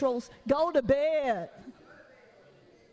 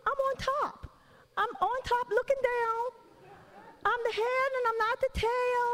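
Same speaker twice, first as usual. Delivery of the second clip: much higher, smug holier-than-thou voice; high preening mock of a too-spiritual believer; mock super-spiritual; 11 semitones higher than usual. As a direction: lampoon someone who claims to be on top. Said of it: "We're like".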